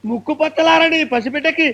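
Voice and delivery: ordinary delivery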